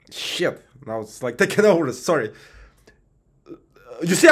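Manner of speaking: normal talking voice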